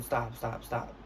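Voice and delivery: normal talking voice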